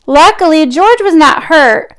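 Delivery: ordinary delivery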